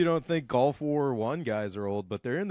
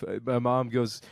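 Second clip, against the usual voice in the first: normal talking voice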